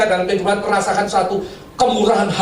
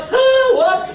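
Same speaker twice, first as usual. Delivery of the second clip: very high, loud mock snap; imitating clerk; 4 dB louder than usual. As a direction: play the rude clerk. Clerk's rude reply retold.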